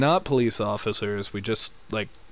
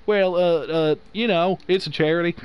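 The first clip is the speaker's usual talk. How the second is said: mock Southern phone voice; 6 semitones higher than usual. Telemarketer stalling a curious caller, in a slight Southern voice. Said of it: "make it sound good in the voice".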